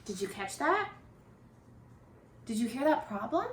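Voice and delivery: usual speaking voice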